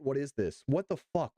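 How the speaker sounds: normal talking voice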